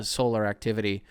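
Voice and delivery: ordinary talk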